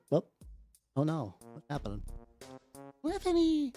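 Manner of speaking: ordinary talk, nothing marked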